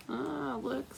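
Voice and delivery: ordinary delivery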